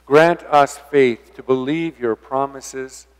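ordinary delivery

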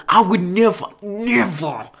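normal talking voice

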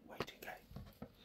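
whispered repeat. Real, not acted. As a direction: whisper it under your breath.

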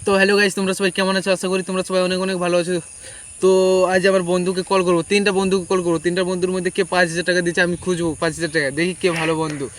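ordinary delivery

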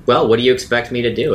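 ordinary talk, nothing marked

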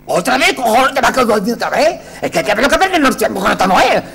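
high, loud impatient director's yell; mock angry shout. Director yelling at the actress in rehearsal, angry shout. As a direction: bark it like a fed-up director.

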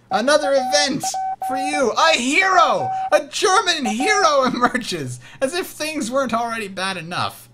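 high, theatrical announcement; mock-dramatic dismay. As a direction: proclaim the bad news in hammed-up outrage. Mock dismay at enemy hero card.

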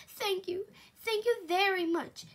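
high, bubbly grateful voice; playful thanks. A narrator's reading. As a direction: gush thanks happily. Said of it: "bubbled playfully".

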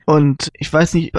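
usual speaking voice

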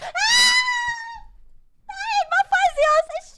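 very high, loud drawn-out shriek; panicked scream. Real, not acted. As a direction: shriek in nervous panic at the reminder. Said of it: "Ich bin literally am Zittern". (hoher, langgezogener Panikschrei)

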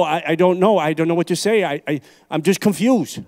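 ordinary delivery

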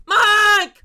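very high, loud shriek of Mike; imitating the Mike yell. Commentator mimics the woman yelling Mike, high loud shriek. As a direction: screech the name like the clip. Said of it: "How is she nailing the tone?".

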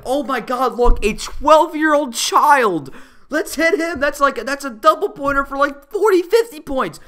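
loud, high-pitched imitated glee; mock excited bully. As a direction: crow over a prize target. Voices bullies spotting a target.

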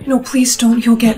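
loud, urgent begging; alarmed plea. Acted. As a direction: beg her urgently to stop. Stops her fetching the manager.

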